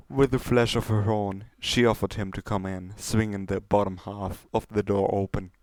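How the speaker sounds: ordinary delivery, nothing marked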